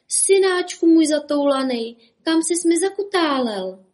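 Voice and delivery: high, loud mother calling. A narrator's reading. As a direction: call out for a lost child. Mother calling lost son. (volající matka)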